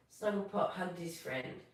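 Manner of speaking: usual speaking voice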